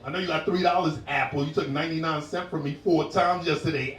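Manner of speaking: usual speaking voice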